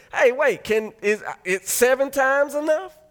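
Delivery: high, loud, slow incredulous question; Peter asking. Retold or imitated. Peter asking Jesus, high and loud, hoping seven is enough. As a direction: drag it out like you can't believe it's enough.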